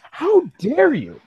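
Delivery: ordinary delivery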